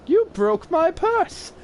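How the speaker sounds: very high, slow shrieking lady voice; mock angry woman. Woman accusing boy over purse, high slow mock shriek. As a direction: shriek it slowly like an outraged woman. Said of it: "looked me in the eyes and said".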